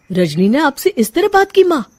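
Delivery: can't believe it; shocked. Acted. Son asking mother in shock about his wife's rudeness.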